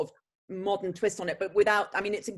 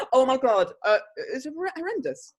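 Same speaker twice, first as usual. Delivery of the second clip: normal talking voice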